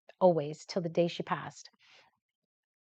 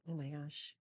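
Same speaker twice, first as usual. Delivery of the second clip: choked up; 4 semitones lower, 14 dB quieter than usual. Real, not acted. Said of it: "getting emotional".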